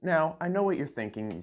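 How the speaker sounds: ordinary talk, nothing marked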